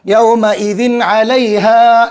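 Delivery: "यौमइदिन अलैहा" with short n and long vowels; unheld n recitation. Shown as a demonstration. Teacher models reciting "यौमइदिन अलैहा" without nasal hold on n. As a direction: recite "यौमइदिन अलैहा" measured, n running straight into अलैहा. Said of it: "जल्दी पढ़ देंगे".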